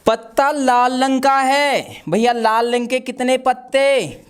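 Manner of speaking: normal talking voice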